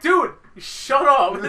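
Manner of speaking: loud, high, drawn-out mock yell; slow, hammed-up fed-up shout; acted exasperation. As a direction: shout down a panicking friend, half laughing. Acted quarrel with panicking friend.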